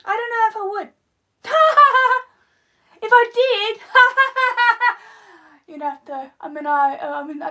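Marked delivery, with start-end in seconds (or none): 1.5-2.2 quick burst of laughter. Real, not acted. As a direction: laugh out loud at your own idea. Laughs at own rocket idea.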